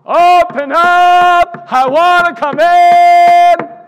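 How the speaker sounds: very loud, high, slow call through the door; Jesus calling. Pretend. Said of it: "he is knocking".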